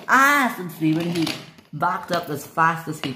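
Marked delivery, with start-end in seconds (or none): 0.1-0.5 short, high, loud cry; alarmed yelp. Acted. Stephen alarmed before backing up, high yelp.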